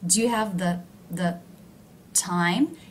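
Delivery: "Do you have the" broken up, "the" kept low, "time" rising; slowed-down demo of low "the". Shown as a demonstration. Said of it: "Do you see how the word the is low in pitch".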